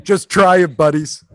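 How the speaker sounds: loud cocky "try it, buddies"; taunting dare. Pretend. Louder repeat of taunt.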